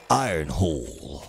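deep, slow commanding robot voice; Optimus Prime voice. Pretend. Streamer voicing the transformer leader, deep and slow. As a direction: rumble it low like the transformer leader.

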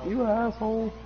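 high-pitched imitation of a distant yell; faint mock yell. Player imitates a guy heard in the game, faint mock yell. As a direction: mimic someone yelling far off, without real volume. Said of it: "hear that guy faintly yell".

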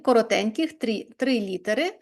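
normal talking voice

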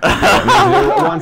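high squeak through laughter; laughing. Real, not acted. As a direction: crack up mid-word.

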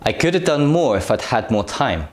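quick, with "have" reduced to "əv"; rapid connected speech, "could have" as "could've"; fast natural example. Shown as a demonstration. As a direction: say it fast, with the weak forms. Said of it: "Let's do one more example".

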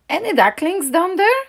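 Mama calls down to the log, high and loud; called. A narrator's reading. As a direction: call out across the pond, searching. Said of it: "she called".

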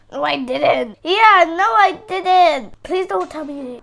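ordinary delivery

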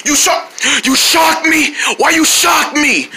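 high, slow mock outrage; odd put-on voice. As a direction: protest in a strange high toy-character voice. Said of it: "What's wrong with your voice?".

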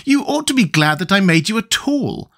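put-on high-pitched indignant boy; boyish, affronted retort. A narrator's reading. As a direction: scold back in a high voice. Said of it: "returned Tip indignantly".

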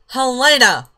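loud high call of the name. Real, not acted. Player shouting to her sim Helena, loud call. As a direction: yell across the room for her.

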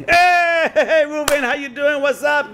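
hammed-up chummy greeting to a friend at shul; mock buddy greeting. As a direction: call out like the cool guy.

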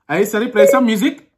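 loud, high Pakistani accent. Shown as a demonstration. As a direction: bellow the command in a Pakistani accent. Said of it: "let me do the Pakistani accent".

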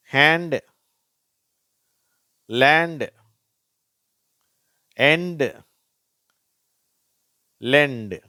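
"ஹேண்ட், லேண்ட், எண்ட், லெண்ட்" loud, ending in "ndu"; ND sound demo. Shown as a demonstration. Teacher reading ND examples, "ஹேண்ட், லேண்ட், எண்ட், லெண்ட்" loud and separate. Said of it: "இண்டு அப்படிங்கிற உச்சரிப்பு வருது".

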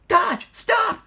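high, loud warning shout; panicked high-pitched yell; alarmed cry. A narrator's reading. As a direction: cry out to halt a friend. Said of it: "cried Lady".

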